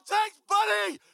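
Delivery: high, loud mock-panicked yell; indignant shout. Chased boy to friend who left him, high loud shout. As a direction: yell it after a deserting friend.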